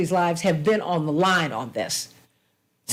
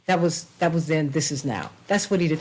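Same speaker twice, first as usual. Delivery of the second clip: usual speaking voice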